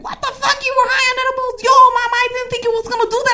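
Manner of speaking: high, scandalized Puerto Rican friend; hammed-up Puerto Rican outrage; Puerto Rican accent. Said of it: "I made him sound Puerto Rican".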